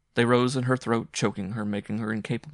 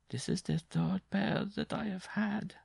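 hoarse; 12 semitones higher, slower than usual. Acted. Jean to Simon about her worn shoes, hoarse and slow. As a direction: let the voice wear thin and slow. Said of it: "she continued hoarsely".

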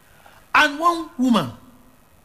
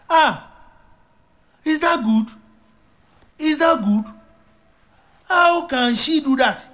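indignant outburst; 10 semitones higher, 4 dB louder than usual. Real, not acted.

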